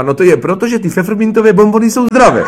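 very high, loud, overexcited delivery; imitating a hopeless joke-teller climbing to a shout; triumphant botched punchline. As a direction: blurt the punchline proudly.

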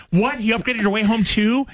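high shocked disbelief. Retold or imitated. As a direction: gasp it out in disbelief. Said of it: "he'll think".

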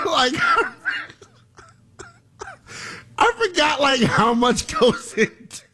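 high, wheezing words through laughter; laughing. Real, not acted. Host trying to explain himself, laughing. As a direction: squeeze the words out between real laughs. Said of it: "I'm laughing because".

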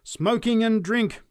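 high, loud, slow lament; fervent preacher. A narrator's reading. Preacher laments to the crowd, slow fervent preaching. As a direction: draw out the denunciation. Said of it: "she continued".

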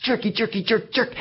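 put-on high kid voice flinging an insult; high childish name-calling. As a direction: spit out the worst word a kid knows. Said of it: "the worst thing I could think of".

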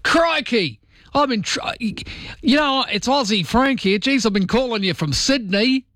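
high-pitched, exaggerated Australian twang; over-the-top Aussie phone greeting; hammed-up Aussie caller. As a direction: ham up the Australian accent for an overseas listener. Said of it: "You've gotta call America and you've gotta go".

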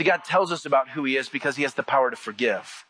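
ordinary delivery

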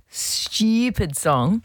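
slow, drawn-out Aussie "stupid"; Australian accent. Retold or imitated. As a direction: imitate an Australian singing the title. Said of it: "in an Australian accent".

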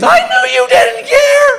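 very high, hammed-up wailing; tearful wife. Wife blaming husband in the security line, tearful wailing. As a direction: wail through a mock tearful tirade. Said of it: "Here comes the tears".

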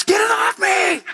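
high, slow mock scream about a spider; mock panicked shriek. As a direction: shriek like a spider just landed on you. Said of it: "It's like spider dance".

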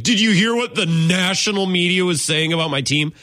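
mock small-town fan whining; hayseed fan. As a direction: play a yokel griping about the media. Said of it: "hayseed behavior to be like".

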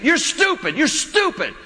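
mock a small boy yelling at his mother; bratty kid yelling. Pastor imitates a kid at Walmart yelling at his mom, high and whiny. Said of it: "he just kept saying it".

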